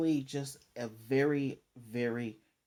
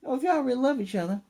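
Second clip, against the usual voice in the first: excited demand; 11 semitones higher, 4 dB louder than usual. Retold or imitated. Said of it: "And dude's like".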